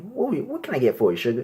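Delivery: high, sweet waiter asking; sassy waiter voice. Retold or imitated. Comic acting out a waiter at his table, high and sweet. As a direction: pitch it up like a flirty server. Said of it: "a waiter comes by".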